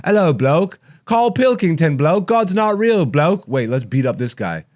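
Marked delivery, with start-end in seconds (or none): 0.1-3.5 high, loud mock British Ricky voice; a hammed-up bad British impression; imitating a cheeky English comedian badly; bad British accent. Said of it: "I can't do a British accent".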